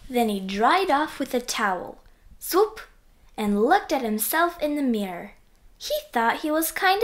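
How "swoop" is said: high swoop sound effect. A narrator's reading.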